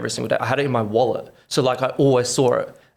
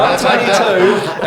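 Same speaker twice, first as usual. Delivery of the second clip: laughing disbelief; 9 semitones higher, 7 dB louder, much slower than usual. Real, not acted. Repeats age laughing.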